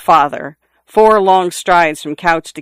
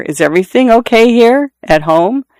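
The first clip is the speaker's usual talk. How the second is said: raised, questioning teacher voice; probing concern; 6 semitones higher than usual. A narrator's reading. Biology teacher asking student about home, probing concern. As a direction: lift it into a pointed concerned question.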